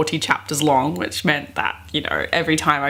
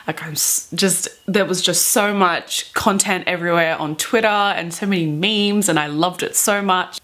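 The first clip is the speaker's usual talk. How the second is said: ordinary delivery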